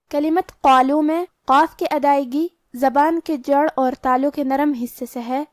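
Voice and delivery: ordinary delivery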